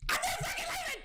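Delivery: very high, screamed movie-line imitation; Leonardo DiCaprio. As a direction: scream it like a defiant film hero. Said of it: "just like Leonardo DiCaprio".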